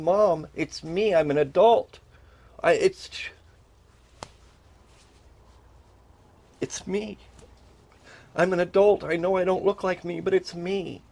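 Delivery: high, insistent pleading to be recognized; insistent plea. Retold or imitated. Grown son begging his mom to know him, insistent plea. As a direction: plead to be recognized, pitch held up.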